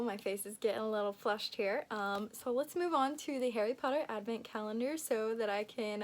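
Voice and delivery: ordinary talk, nothing marked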